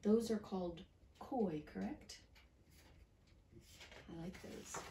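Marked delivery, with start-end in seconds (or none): none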